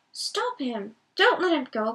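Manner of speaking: urgent cry to stop him. Acted. Pippin begs the others to stop Frodo, crying out. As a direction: shout it after the running figure. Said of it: "cried Pippin".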